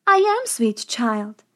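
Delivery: slow, lightly voiced old woman; a hint of a sweet-talking apple seller. A narrator's reading. Disguised witch answers as old woman.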